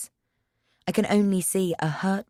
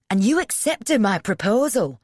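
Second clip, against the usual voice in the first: loud, higher accusing question; furious demand; 5 semitones higher, 6 dB louder than usual. A narrator's reading. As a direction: demand the answer in fury. Darren furious in argument.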